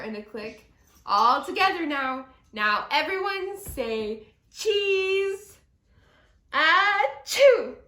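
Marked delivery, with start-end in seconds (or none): 1.1-5.6 louder, eager camera call; rally everyone for the photo; cheery photo call. Acted. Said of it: "said Moose, ready with his camera". 6.5-7.8 big sudden achoo; loud sneeze. Acted. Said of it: "That was a sneeze".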